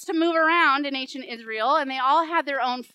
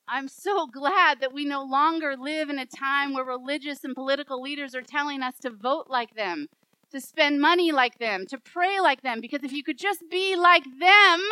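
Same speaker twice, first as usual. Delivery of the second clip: mounting irony; 4 dB louder than usual. Real, not acted. Preacher mocks leaders' demands to congregation, mounting irony. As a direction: build from even to loud and emphatic. Ironic claim repeated, building in force.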